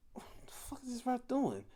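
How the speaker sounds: hushed, breathy whisper of disbelief; incredulous whisper. Retold or imitated. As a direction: whisper it like you can't believe your eyes. Baffled by ref's behaviour.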